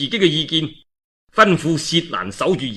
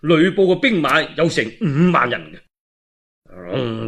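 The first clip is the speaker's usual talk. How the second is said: plain reading voice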